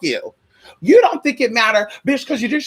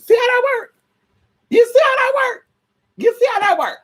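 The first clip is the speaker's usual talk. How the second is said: high squeal; 13 semitones higher than usual. Real, not acted. Repeated rhetorical squeal.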